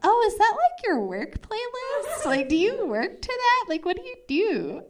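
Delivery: high, sing-song ditzy questions; clueless questioning. Acted. Asking a date about his playlist, mock-clueless. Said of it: "my dumb ass is like".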